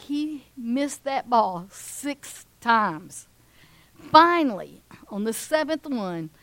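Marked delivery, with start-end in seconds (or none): none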